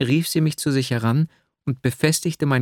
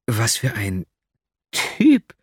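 exclaiming; much slower than usual. A narrator's reading. Girl hearing he calls himself a type, exclaiming. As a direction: burst out in amused astonishment. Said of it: "Rief das Mädchen aus und lachte".

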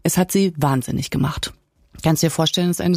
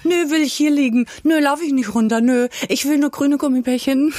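high-pitched mock-bratty refusals; hammed-up high diva whine; playful diva; 9 semitones higher than usual. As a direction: play a pampered star refusing everything. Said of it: "ich würde mich auch manchmal gern so verhalten".

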